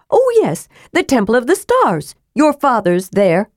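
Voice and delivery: sly kender explaining the way to the rune; Tass the kender. Acted.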